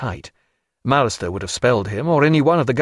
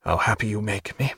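softer, murmured and intimate; low voice; 2 semitones lower, 6 dB quieter than usual. Acted. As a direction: say it low, just for her. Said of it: "said in a low voice".

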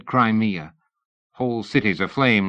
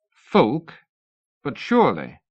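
feigned shock; 6 semitones higher than usual. A narrator's reading. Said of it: "Oliphant feigned shock".

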